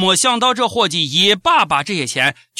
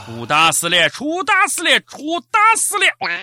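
rising alarm; 7 semitones higher than usual. Acted. Building alarm repeated.

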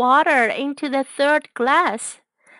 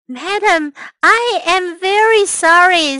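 apologetic; 8 semitones higher, 7 dB louder than usual. A narrator's reading. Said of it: "said Busby".

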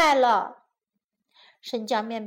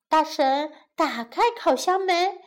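ordinary delivery, nothing marked

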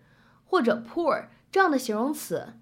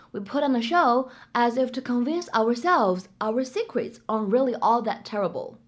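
ordinary talk, nothing marked

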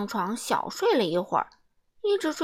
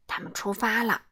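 plain reading voice

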